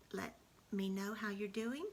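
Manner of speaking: ordinary delivery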